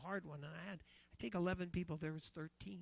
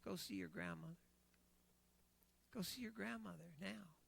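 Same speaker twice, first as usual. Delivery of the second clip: quiet inner voice; 7 dB quieter than usual. Retold or imitated. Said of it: "the Holy Spirit impressed me".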